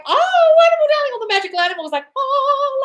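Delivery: very high, loud, swooning delight; over-the-top rapture. Acted. Coach picturing adoring magical animals, hammed-up rapture. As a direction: exclaim it like a fairy-tale princess, hammed-up.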